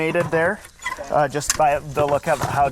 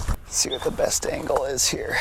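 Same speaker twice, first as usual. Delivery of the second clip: whisper; 6 dB quieter than usual. Real, not acted. Approaching snake, whispering.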